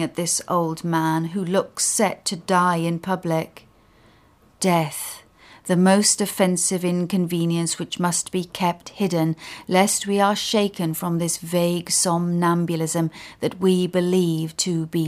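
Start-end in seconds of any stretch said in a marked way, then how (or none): none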